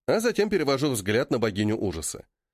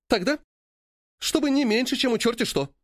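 much higher pleading demand; pushy demand; 11 semitones higher than usual. A narrator's reading. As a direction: press the demand, high-pitched. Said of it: "делает новую атаку Мирантис".

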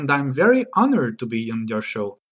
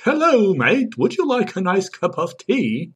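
hammed-up English accent, swooping pitch; 3 semitones higher than usual. Shown as a demonstration. Guest tries an accent for the host, mock English. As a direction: put on a cartoonish English accent. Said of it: "can you do a Scottish or an English accent?".

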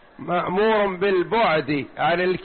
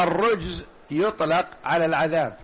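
ordinary delivery

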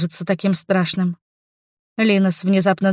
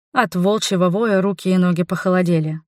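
ordinary delivery

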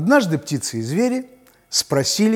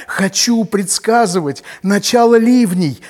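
nightingale voice; 6 semitones higher, 6 dB louder than usual. Acted. Nightingale asks to foretell rain.

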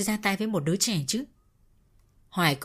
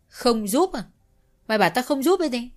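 indignant; 5 semitones higher than usual. A narrator's reading. Brother retorts incredulously.

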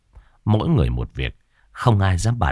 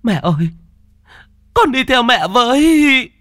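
desperate scream; 7 dB louder than usual. Acted. Daughter screaming to her dead mother before fainting, anguished. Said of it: "hét lên một câu".

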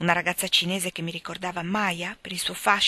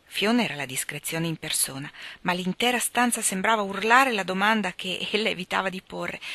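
ordinary delivery, nothing marked

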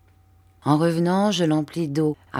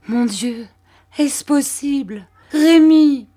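higher and higher, disbelief breaking into his name; stunned recognition; 9 semitones higher, 8 dB louder, slower than usual. A narrator's reading. Mère Barberin, recognising Rémi, cries out in disbelief. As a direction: gasp it out, then cry the name louder. Mother recognises lost son.

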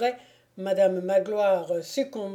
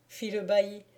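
plain reading voice

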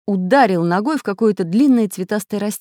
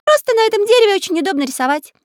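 much higher, chirpy character voice; high cartoon voice; 6 dB louder, faster than usual. A narrator's reading. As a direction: squeak it out like a toy pencil. Magic pencil character speaks.

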